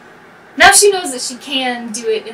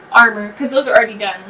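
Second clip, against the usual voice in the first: ordinary talk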